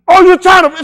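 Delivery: loud high accusing; mock angry yell. Woman confronting him, loud and high. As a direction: throw it at him sharply.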